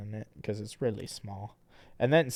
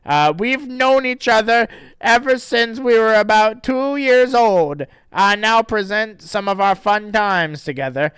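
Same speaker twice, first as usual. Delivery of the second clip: character voice; 10 semitones higher, 10 dB louder than usual. Acted. Reading the dedication in voice.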